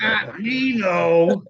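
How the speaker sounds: loud, slow, high mock-terrified "Not Nino"; mock dread. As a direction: drag it out in hammed-up fear. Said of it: "mock dread at uncle's name".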